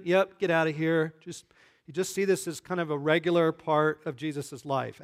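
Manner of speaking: normal talking voice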